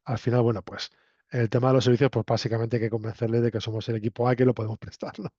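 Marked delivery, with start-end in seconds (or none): none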